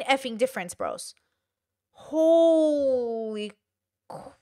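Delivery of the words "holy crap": long-drawn amazed exclamation; floored, words dragged out; astonished. Real, not acted. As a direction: stretch HOLY out, pause before CRAP. Astonished at subscriber growth.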